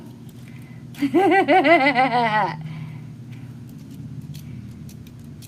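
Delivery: long real laugh fading out; drawn-out genuine laughter. Real, not acted. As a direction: keep laughing, slowly winding down. Laughs at zip tie gun.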